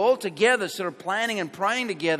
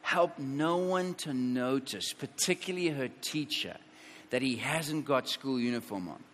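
softer, lower murmured prayer to God; quiet retold prayer; 6 semitones lower, 8 dB quieter than usual. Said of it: "she's saying".

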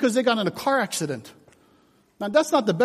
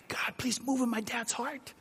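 hushed prayer; 2 semitones higher, 12 dB quieter than usual. Retold or imitated. Family prayer recalled.

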